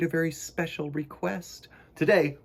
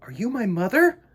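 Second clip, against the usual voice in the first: little bird crying out; 9 semitones higher, 8 dB louder than usual. Acted. Choco calling to Missus Penguin, high and loud. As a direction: call out eagerly. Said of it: "he cried".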